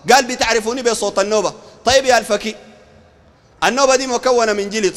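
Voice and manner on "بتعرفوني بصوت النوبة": contained, matter-of-fact quoted reply; understated voice of the sheikh replying; sheikh's answer. Acted. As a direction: answer the followers evenly. Acts sheikh's answer to followers.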